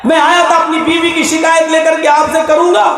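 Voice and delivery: complaining husband. Retold or imitated. Man complaining to Umar.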